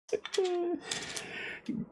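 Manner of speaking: soft, high laugh. Real, not acted. Streamer remembering the bat night, soft laugh. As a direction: let out a soft breathy chuckle.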